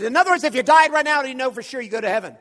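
fast, loud, high question; brusque challenge. Retold or imitated. Preacher hurries through his question hoping for a no, brusque and loud. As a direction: rattle it off forcefully in one breath. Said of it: "I said this just like this".

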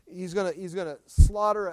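ordinary delivery

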